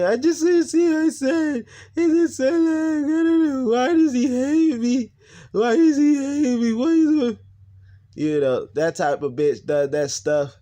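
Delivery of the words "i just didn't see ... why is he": high, loud mock wailing; hammed-up sobbing, drawn-out words; fake crying. Imitating mother fake crying to the cops, mock screaming sobs. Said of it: "fake crying, uh, doing the waterworks, all that, screaming".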